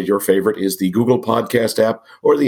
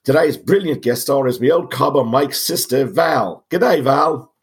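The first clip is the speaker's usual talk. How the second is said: lightly put-on Aussie accent, a bit higher; hammed-up mock Australian host; bad Australian accent; 5 semitones higher than usual. Podcast host opening the show in mock Australian accent. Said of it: "now I'll stop with the bad accent".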